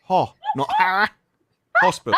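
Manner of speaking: slow, laughing demo: "hospital" with an open British o; correct pronunciation. Shown as a demonstration. Teaching friends British "hospital", laughing and slow. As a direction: sound out "ho-, not ha-" then say "hospital" up high. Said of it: "Not hospital".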